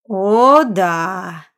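stretched-out smug drawl; drawling vowels. Acted. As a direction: drag the vowels out indecently. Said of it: "Растягивая гласные до неприличия".

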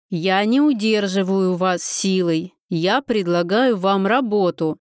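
plain reading voice

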